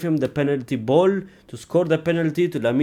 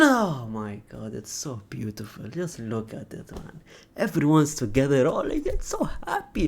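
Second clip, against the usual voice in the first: soft gushing; 3 semitones lower, 11 dB quieter than usual. Real, not acted. Fan admiring Barcelona lineup, soft dreamy gush. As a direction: hush it like something precious.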